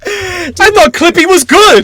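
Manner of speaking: mock crying. Mourning the paperclip with a torch, slow mock sob. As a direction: wail it like a hammed-up heartbroken fan.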